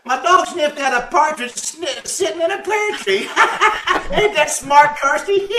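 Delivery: high, loud punchline breaking into laughter; delighted, high-pitched cackling delivery; laughing at own joke. Acted. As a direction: crow the pun and crack up.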